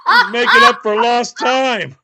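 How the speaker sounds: laughing through the words. Real, not acted. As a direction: giggle it out, loud. Laughing at own joke.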